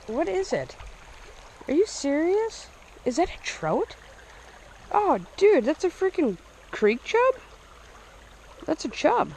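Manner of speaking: high, incredulous questioning; excited disbelief. Real, not acted. Angler works out what fish he caught, incredulous. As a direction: pitch it high, amazed at the catch.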